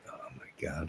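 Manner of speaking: breathless whispered oh my God; whispered gasp. Acted. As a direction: hush it in shock. Shocked at himself.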